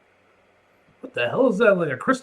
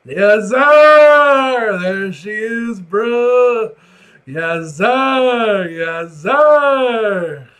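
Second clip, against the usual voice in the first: loud, high whoops of joy; overjoyed cheer; 6 semitones higher, 10 dB louder, much slower than usual. Real, not acted. Cheering loudly to the viewers about finally getting coffee. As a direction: shout it like a victory.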